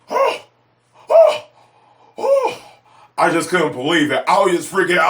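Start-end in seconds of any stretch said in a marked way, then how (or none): 0.1-2.6 high, breathless oh oh oh with a laugh; stunned excitement. Retold or imitated. Fan reliving the last act, squealing high in disbelief. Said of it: "I was just like".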